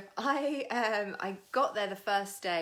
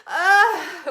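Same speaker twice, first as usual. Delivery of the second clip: loud, high cathartic groan; imitated groaning; 11 semitones higher, 16 dB louder than usual. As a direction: let out a big mock clearing groan. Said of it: "there's all the groaning and the".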